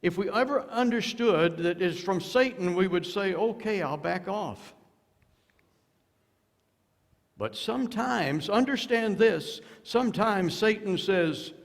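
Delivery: high, loud pulpit warning; preaching at full force. Real, not acted. Preacher warning the congregation about Satan, high and loud. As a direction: drive it home to the congregation.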